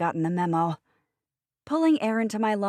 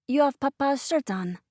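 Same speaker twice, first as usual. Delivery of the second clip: put-on high, eager little French boy; child delighted with his picture; 8 semitones higher than usual. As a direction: voice a small child proudly showing his drawing. Child Kristoff voiced.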